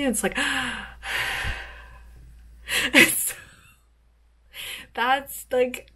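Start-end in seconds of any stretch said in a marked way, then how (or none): 0.4-1.7 long, airy demonstrative exhale; finally taking a breath. Shown as a demonstration. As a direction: let out a big overdue breath. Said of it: "take a breath finally".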